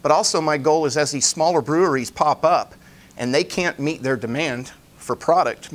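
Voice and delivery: ordinary delivery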